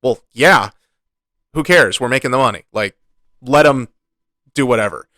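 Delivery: a hint of a careless corporate suit; dismissive bean counter. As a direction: shrug it off like a greedy executive. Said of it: "some bean counters were like".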